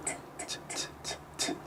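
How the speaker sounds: whispered crisp "T, t, t, t" with no voice; repeated T sounds. Shown as a demonstration. Therapist modelling the T sound for parents. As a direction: tap out voiceless T's one after another. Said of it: "say the T sound over and over".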